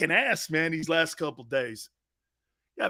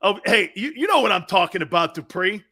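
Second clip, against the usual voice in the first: normal talking voice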